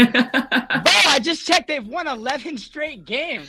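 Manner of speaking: high, slow, incredulous; stunned disbelief. Real, not acted. Kraken fan stunned by the Oilers' streak, disbelieving. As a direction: spell out shock slowly.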